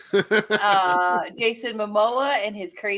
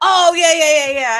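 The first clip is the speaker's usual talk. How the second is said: sudden recognition; 8 semitones higher, 12 dB louder than usual. Retold or imitated. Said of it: "they're like".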